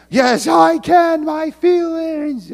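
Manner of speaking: high, loud mock-whine of a child; whiny kid. Child shouting back at mom over sugar, mock whine. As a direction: squeal it like a kid demanding sugar.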